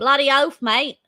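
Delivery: weak attempt at Aussie accent; a shaky try at broad Aussie; aussie tradesman. Shown as a demonstration. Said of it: "No, no, that was terrible".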